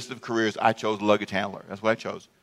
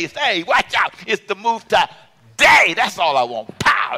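loud, high, worked-up testimony; high-energy preacher's holler; excited full-force preaching; 9 semitones higher, 9 dB louder than usual. Real, not acted. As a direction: burst out with it like reliving the game.

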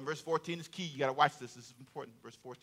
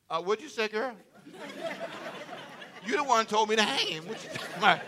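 1 mock Haman answer; 4 semitones higher, 5 dB quieter than usual. Haman answering wife, laughing.

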